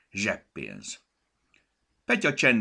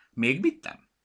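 higher, louder huffy retort in a different voice; offended retort; 8 semitones higher, 7 dB louder than usual. A narrator's reading. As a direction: snap back, clearly offended. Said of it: "Gombi sértődötten válaszolt".

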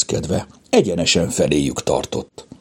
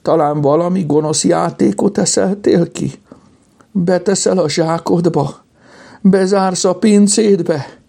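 high, fearful wail; tearful; 11 semitones higher than usual. A narrator's reading. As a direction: whimper each question, pitch held high. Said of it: "Szólalt meg sírós hangon Kajtánka".

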